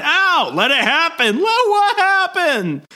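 very high-pitched panicked squawk; hammed-up outcry at sudden pain; mock pained yelp. Hero having a bullet pulled from his head, mock pained yelp.

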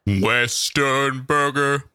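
slow, stretched put-on voice; drawn-out announcement. Shown as a demonstration. Host mock-announces the burger's name, slowly drawn out. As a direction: stretch out each syllable theatrically.

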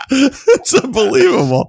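high, laughing disbelief. Real, not acted. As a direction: laugh through the complaint. Laughing at co-host's stolen pick.

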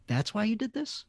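skeptical wife. Retold or imitated. Wife questioning his motive.

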